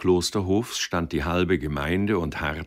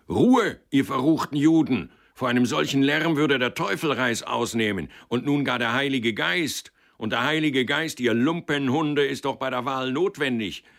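booming, much higher and louder bellow; stentorian shout; 7 semitones higher, 4 dB louder than usual. A narrator's reading. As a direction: roar at the crowd like a gatekeeper. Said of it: "rief dann mit Stentorstimme".

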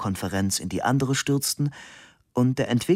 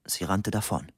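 plain reading voice

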